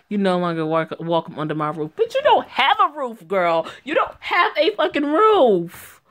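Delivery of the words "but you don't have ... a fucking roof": higher and louder, dragging out the last line; mocking outrage. Real, not acted. Streamer scoffing at a character who has no roof, loud and mocking. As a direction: throw it back at her, scornful.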